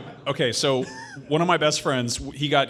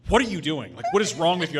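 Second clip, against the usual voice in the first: imitating angry mom; 5 semitones higher, 5 dB louder than usual. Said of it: "she's like".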